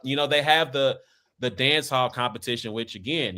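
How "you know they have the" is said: usual speaking voice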